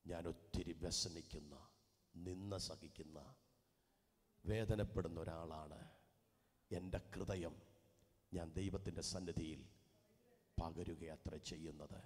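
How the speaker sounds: a hint of Hannah's mournful plea; sorrowful plea. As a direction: pour it out quietly like a broken heart. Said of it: "അവൾ പറയുന്നുണ്ട്".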